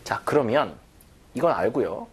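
usual speaking voice

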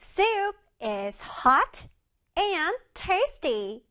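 very slow, each word drawn out; slow model reading. Shown as a demonstration. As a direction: read slowly for the kids to copy. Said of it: "문장에서 볼게요".

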